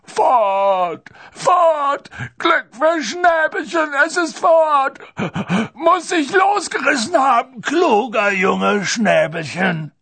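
loud, high, slow howling sobs; blubbering, overjoyed bellow; sobbing for joy. A narrator's reading. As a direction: wail the words through sobs. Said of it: "Hagrids Worte durch sein Schluchzen".

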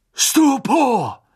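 high, forceful shout; booming battle cry; thundered spell. A narrator's reading. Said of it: "donnerten zwanzig Stimmen".

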